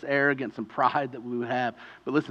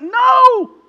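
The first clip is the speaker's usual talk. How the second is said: sharp shout, much higher and louder; 18 dB louder than usual. Acted. Rescuer shouting down the pleading man. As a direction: cut him off hard.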